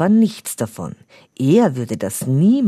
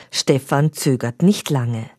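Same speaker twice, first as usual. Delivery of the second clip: plain reading voice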